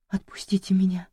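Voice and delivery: шёпотом, в ужасе; умоляющий шёпот пленницы; испуганный шёпот. Acted. Жертва умоляет в страхе. (испуганный шёпот)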